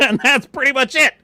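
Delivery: high, loud, laughing as he wraps up; laughing sign-off. Real, not acted. Streamer wrapping up the rant, laughing loudly. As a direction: chuckle out the ending.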